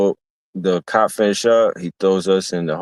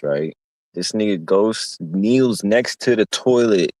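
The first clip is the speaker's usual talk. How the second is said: ordinary talk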